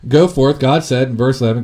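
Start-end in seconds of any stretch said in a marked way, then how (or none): 0.1-0.6 loud, firm command; God's voice ringing out. Acted. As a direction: boom it out as God commanding. God's command in scripture reading.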